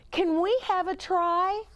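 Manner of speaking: put-on high, eager boys' plea; eager cry. A narrator's reading. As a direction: beg for a turn, high and keen. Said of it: "the boys cried".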